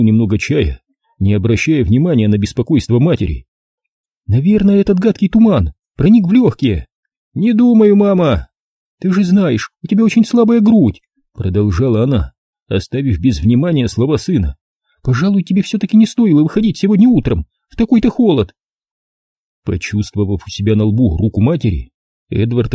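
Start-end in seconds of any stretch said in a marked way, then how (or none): 4.3-11.0 high, fretful mother's voice; a doting mum sure he is ill; mother worrying about the fog and his weak chest, fretful; fussing mother. A narrator's reading. 15.0-18.5 high, fretful mother's voice; a doting mum sure he is ill; mother worrying about the fog and his weak chest, fretful; fussing mother. A narrator's reading.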